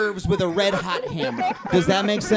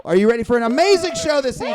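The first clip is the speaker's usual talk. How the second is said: louder, fast, rising crowd pump-up; hype announcer; 4 semitones higher, 7 dB louder, much faster than usual. Shown as a demonstration. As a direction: whip the crowd up. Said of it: "crowd hype repeated".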